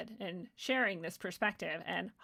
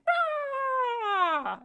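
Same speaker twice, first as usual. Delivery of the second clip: high loud mock roar of outrage; mock outraged roar; 7 dB louder than usual. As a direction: bark out a cartoon rage cry. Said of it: "everyone's like".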